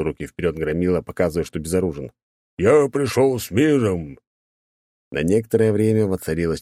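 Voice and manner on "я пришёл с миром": big knight voice. Acted.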